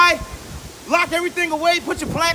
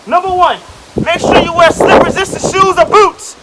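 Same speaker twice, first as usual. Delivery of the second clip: loud shouted tips; shouting over wind; 11 dB louder than usual. Acted. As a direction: yell it over a storm.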